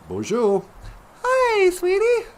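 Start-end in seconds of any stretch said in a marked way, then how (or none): none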